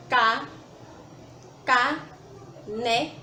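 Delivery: "Ca-", then "caneta" slowly as ca-ne-ta; syllable by syllable. Shown as a demonstration. Teacher counts syllables on fingers, saying "caneta" in three separated beats. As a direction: count out the syllables of "caneta" with pauses, after a first firm "Ca-". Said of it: "Ou podemos fazer no dedo".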